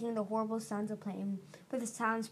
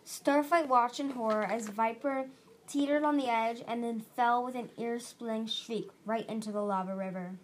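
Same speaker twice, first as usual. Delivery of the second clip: ordinary delivery, nothing marked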